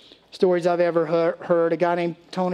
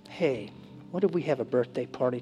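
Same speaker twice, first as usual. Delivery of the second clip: much softer, lower proposal; quiet hopeful idea; 4 semitones lower, 7 dB quieter than usual. Retold or imitated. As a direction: float the idea softly.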